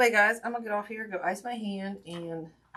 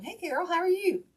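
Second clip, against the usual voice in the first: bright sing-song hello, pitched much higher; high greeting; 12 semitones higher, 5 dB louder than usual. Real, not acted. Host greeting Carol arriving, high sing-song hello. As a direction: greet her in a high, lilting voice.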